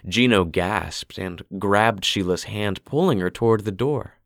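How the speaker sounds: usual speaking voice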